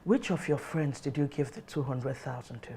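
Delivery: low, very soft questioning; hushed, stern demand; stern interrogation. Acted. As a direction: interrogate quietly, keeping it controlled. Mother interrogates son.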